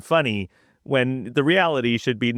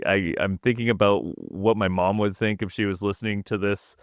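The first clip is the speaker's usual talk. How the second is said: usual speaking voice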